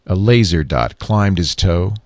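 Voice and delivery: ordinary delivery, nothing marked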